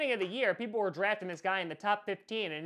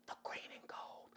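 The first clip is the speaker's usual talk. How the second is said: whisper; 19 dB quieter than usual. Acted. Whispered aside after bold boast.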